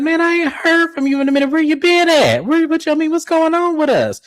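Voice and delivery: high, earnest mock voice for Glenn; imitating Glenn. Glenn pleading with his old friend Cliff, high mock voice. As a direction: squeak it out like a wounded buddy. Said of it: "And Glenn said".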